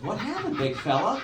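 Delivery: high, mock friendly cop; cop's voice. Cop teasing the comic, high mock voice.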